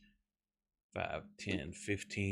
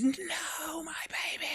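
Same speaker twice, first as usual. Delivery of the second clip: very high, drawn-out wail; hammed-up high-pitched scream without real volume; mock scream; slower than usual. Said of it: "she just, like, screams".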